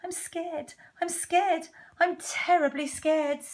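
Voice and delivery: high-pitched frightened refrain; hammed-up scared cook; acted fear. Cook declares his fear.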